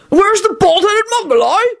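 high, rolling giant's bellow; rolling Hagrid voice. Acted. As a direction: boom it out like a rowdy giant. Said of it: "he rolled at Quentin".

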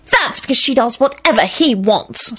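mild high-pitched irritation; touch of peevish insistence; irritable. A narrator's reading. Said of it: "Solange snapped irritably".

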